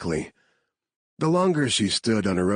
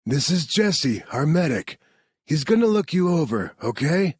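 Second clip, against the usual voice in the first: reassuring rescuer voice; 9 semitones higher than usual. Acted. Narrator voices Marcus introducing.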